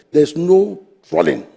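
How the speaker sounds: ordinary talk, nothing marked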